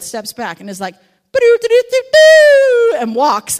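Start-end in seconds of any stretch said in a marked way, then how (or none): 1.3-3.0 high, loud sung-out call to the flock; shepherd's call. Retold or imitated. Twelve-year-old shepherd calling his sheep, loud high call.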